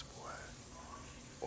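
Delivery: ordinary talk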